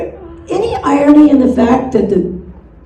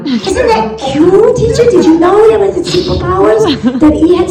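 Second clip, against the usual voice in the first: put-on higher-pitched, gushing child voice; delighted little kid; 5 semitones higher than usual. Little Bobby tells teacher about E's superpowers, gushing childish delight. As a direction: gush like a proud little pupil. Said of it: "little Bobby comes into class and he goes".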